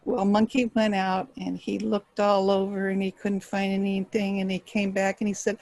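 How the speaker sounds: ordinary delivery, nothing marked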